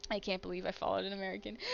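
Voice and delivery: normal talking voice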